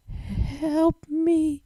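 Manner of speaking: high, fragile plea for help; a hurt pony's faint high plea; weak mutter. A narrator's reading. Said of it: "All she could mutter was".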